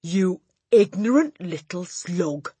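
slow, loud, contemptuous bellow; bellowing Trunchbull. A narrator's reading. Headmistress insulting a pupil, slow bellow. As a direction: spit out the insult slowly and loudly. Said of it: "the Trunchbull bellowed".

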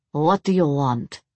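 put-on composed, Russian-accented question; cool Russian accent. A narrator's reading. Vampire princess interrupts her hostess, cool Russian accent. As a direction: cut in coolly with a Russian accent. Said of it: "her voice still cool".

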